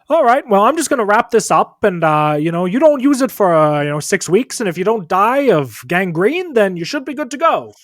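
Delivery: high, loud doctor voice; breezy, loud patter with a lifted pitch; doctor character. Acted. Doctor wrapping up a broken arm, high and loud. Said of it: "And the doctor says".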